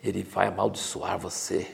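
mock preacher scaring the giver; hushed mock threat of curses; guilt-tripping threat. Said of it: "jogar uma culpa".

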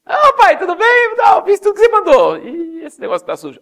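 high, loud shouted fake innocence; a caught kid greeting dad too brightly; mock guilty boy. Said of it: "ele fala assim". (menino culpado, voz aguda e alta)

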